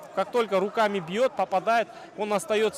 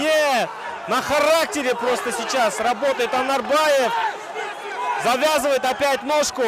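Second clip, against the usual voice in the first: wrestling commentator; 4 semitones higher than usual. Real, not acted. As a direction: shout it over the crowd. Fighter escapes, commentator shouts.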